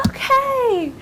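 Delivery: put-on high, drawn kid chorus; agreeing kids. Pupils answering the teacher's rules, high and obedient. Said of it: "And they're like".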